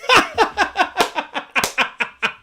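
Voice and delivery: amused laugh at opponents; real laugh. Real, not acted. Host laughing at critics, real amused laugh. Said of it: "it's hilarious".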